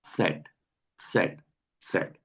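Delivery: "सेट" low, quiet and slow, a light T before it; soft T before zet. Shown as a demonstration. Teacher shows how the letter zet begins. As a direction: start "सेट" with a faint T sound. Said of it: "हल्की सी ठे की आवाज".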